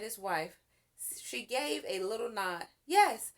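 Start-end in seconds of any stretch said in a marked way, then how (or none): 2.8-3.3 higher, louder eager yes; yelled. A narrator's reading. Boys agreeing to go on the rescue, yelling. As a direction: cheer it. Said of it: "yelled Stanley and Arthur".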